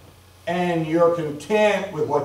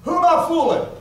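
pitched way up, mock incredulous; self-mocking; 11 semitones higher than usual. Real, not acted. Preacher laughing at his own age, high incredulous question. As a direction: ask it like you can't believe yourself.

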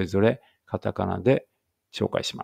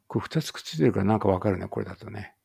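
ordinary delivery

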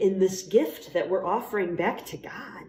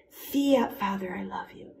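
whispered prayer. Acted. Speaker models a simple prayer in a hush.

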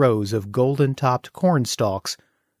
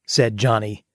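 usual speaking voice

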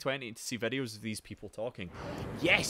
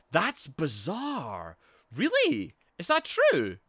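much higher, louder astonished questions; amazed disbelief; 11 semitones higher, 6 dB louder, slower than usual. Real, not acted. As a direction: gasp in real disbelief. Reacts to surprising accent fact.

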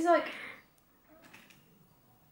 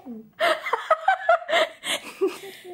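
high squealing laugh. Real, not acted.

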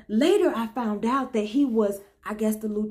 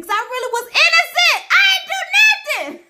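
very high, loud hammed-up wail; wailing protest; 12 semitones higher, 6 dB louder than usual. Jailed woman protesting her innocence to the Lord, comic wail. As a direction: cry out your innocence with a laugh in it.

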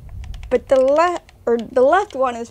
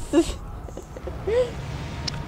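breathy laugh; 7 semitones higher, 9 dB quieter than usual. Real, not acted. Laughing at dog peeking.

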